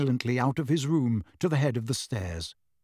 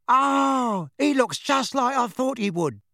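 excited; 10 semitones higher, 3 dB louder than usual. Acted. Eager young witch meets Harry.

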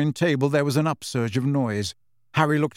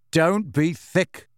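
slow and firm, a touch higher; 4 semitones higher, much slower than usual. A narrator's reading. Harry snapping nervous Ron out of it, firm. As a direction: set him straight, slowly. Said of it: "said Harry firmly".

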